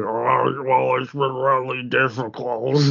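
imitating a flat robotic voice box. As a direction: say it like a damaged voice synthesizer. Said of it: "he's got the robot talking voice".

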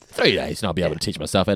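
imitated matter-of-fact confidence; mock certain co-host. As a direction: state it as decided, imitating your co-host. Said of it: "that is just you".

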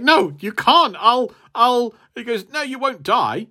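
desperate champion. Retold or imitated. Stranded champion protesting.

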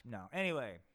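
usual speaking voice

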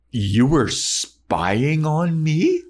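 Buffy hammed-up shocked, slow and high; outraged Buffy. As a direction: stretch it out, scandalised.

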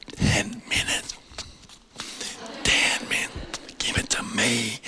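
urgent stage whisper from the back. Retold or imitated. Preacher signals from the back of the church, urgent whisper. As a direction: whisper it so only the preacher hears. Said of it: "I'm at the back, I'm going".

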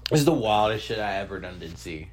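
ordinary talk, nothing marked

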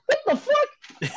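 high, slow, laughing what the fuck; stunned disbelief. Retold or imitated. Told he can meet the band, stunned and laughing. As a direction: draw it out in amazed disbelief.

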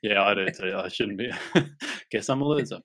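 normal talking voice

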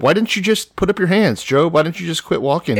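high, sneering scold; mocking cop voice. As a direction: talk down like a smug cop. Mocking cops scolding Job.

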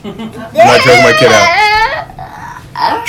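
high, slow laughing yell; laughing shout. Real, not acted. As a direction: protest while cracking up. Laughing protest while kid wrestles.

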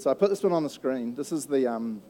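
ordinary delivery

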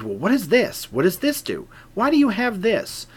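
retold eager fascination; excited curiosity. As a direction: imitate a thrilled ranger, pitch climbing with each question. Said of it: "really excited. He said".

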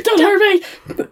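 high, loud comic begging; hammed-up cowering plea; mock terrified plea. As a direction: squeal it in mock fear. Cornered Evan pleads, retold.

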